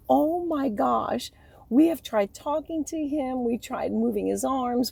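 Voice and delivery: high, loud, astonished rush; a nurse stunned at what she just saw; nurse in shock. Retold or imitated. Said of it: "She's like".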